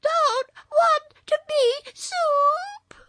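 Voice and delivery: slow, high, loud tearful wail; drawn-out child's wail. A narrator's reading. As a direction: howl it like a small boy crying. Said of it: "wailed Button-Bright again".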